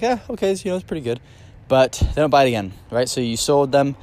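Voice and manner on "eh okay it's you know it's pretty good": high, half-impressed mock customer voice; lukewarm shrug. Customer shrugging off a mediocre supplement, lukewarm and unimpressed. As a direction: play it unimpressed, a meh verdict.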